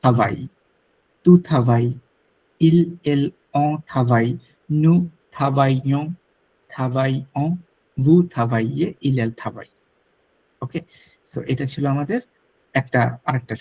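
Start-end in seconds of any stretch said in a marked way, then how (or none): 0.0-9.7 teacher reading a verb table aloud; conjugation drill. Shown as a demonstration. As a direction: recite থাবাই forms slowly and clearly, double l silent. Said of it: "উচ্চারণটা এরকম".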